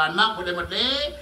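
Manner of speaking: ordinary talk, nothing marked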